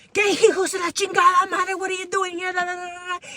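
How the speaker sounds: hammed-up furious mother catching her kid; mom screaming. Mom yelling at her kid on the street. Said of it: "she's like screaming at me".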